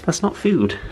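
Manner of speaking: high mild telling-off; gentle scolding to pet. Real, not acted.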